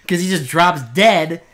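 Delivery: loud, high, exasperated recap; incredulous outburst. Real, not acted. Co-host recapping absurd deaths, loud disbelief.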